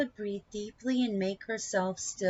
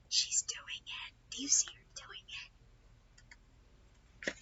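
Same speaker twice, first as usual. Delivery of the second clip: hushed aside to the listener; soft unvoiced whisper; 6 semitones higher, 7 dB quieter, faster than usual. Real, not acted. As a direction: whisper so as not to disturb her. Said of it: "aside to listener".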